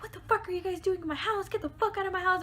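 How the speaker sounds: high, fast angry rant; retold freak-out. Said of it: "super freaking mad. He's, like, freaking out".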